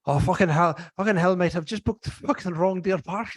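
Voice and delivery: hammed-up Irish caller; strong Irish accent. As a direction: put on a thick Irish accent, swearing in dismay. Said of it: "a strong Irish accent".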